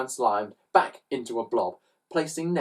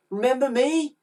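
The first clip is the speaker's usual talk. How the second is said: put-on high-pitched boy's question; expectant boy; 12 semitones higher than usual. A narrator's reading. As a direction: ask hopefully in a raised child's voice. Boy confronts the thieves.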